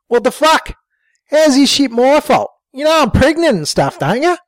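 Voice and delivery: high, indignant bogan woman; high-voiced woman protesting to Jimbo in the car; Sheila's voice. A narrator's reading. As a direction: pitch up into Sheila, outraged.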